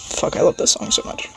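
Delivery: quiet unvoiced aside; hushed aside. Real, not acted. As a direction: drop to a whispered aside. Quiet aside while music plays.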